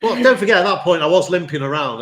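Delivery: protesting. Real, not acted. Player laughed at for losing pleads an injury, loud protesting. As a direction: jump in loudly to defend yourself. Defends himself after being laughed at.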